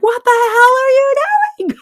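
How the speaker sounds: hammed-up furious fan screaming at the television; yelling at the TV. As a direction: rage at the screen like a frustrated fan. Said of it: "I would yell at them like".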